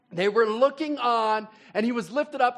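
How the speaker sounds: usual speaking voice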